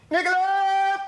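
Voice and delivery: high, loud bellow for a servant; shout. Acted. Husband summoning his servant despite the bell, shouting. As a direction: bellow the name across the house. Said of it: "Don't shout".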